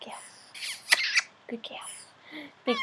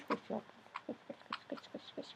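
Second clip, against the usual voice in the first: soft unvoiced whisper; whispered squish; 17 dB quieter than usual. Real, not acted. Owner squishing the bird, soft whisper. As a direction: whisper it while cuddling. Cuddling pet.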